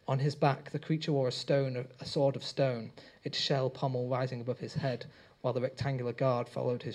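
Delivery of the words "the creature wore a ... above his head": usual speaking voice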